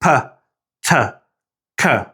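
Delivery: P, T, K as puh, tuh, kuh with bursts of air; English aspirated consonants. Shown as a demonstration. Teacher testing air with a hand at the mouth, aspirated consonants. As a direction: puff air on each of P, T, K. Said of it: "short burst of air".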